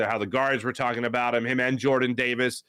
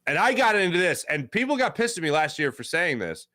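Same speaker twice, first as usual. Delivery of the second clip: ordinary talk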